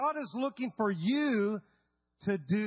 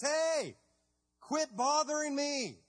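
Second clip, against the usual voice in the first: higher, mock-annoyed brush-off; annoyed God; 6 semitones higher, slower than usual. God telling Moses to quit, mock annoyed. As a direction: brush him off, half joking.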